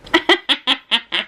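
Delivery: string of short, high giggles; helpless laugh. Real, not acted. Reader tickled by the story, helpless laugh. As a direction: laugh in quick helpless pulses. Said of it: "that's so funny".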